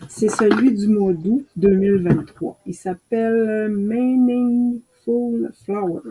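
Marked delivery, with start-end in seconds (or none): 3.7-6.0 slow, careful English with a French accent. Real, not acted. Apologising to viewers for her French-accented English. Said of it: "Excusez mon anglais".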